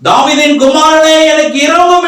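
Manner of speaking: high-pitched acted plea for mercy; blind beggar's plea. Preacher voices blind Bartimaeus begging Jesus for mercy, high acted plea. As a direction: plead up high as the blind man. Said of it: "என்று சொல்லி கேட்கிறான்".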